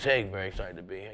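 low, soft deadpan mumble; Stephen Wright impression. Comic imitates Stephen Wright's offstage voice, low deadpan. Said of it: "you talk to Stephen Wright".

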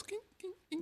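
high squeaky kink sounds; squeaky Grinch noise. Pretend. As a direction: squeak like a heart growing. Grinch heart growing.

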